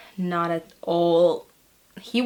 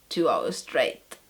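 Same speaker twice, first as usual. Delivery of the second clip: usual speaking voice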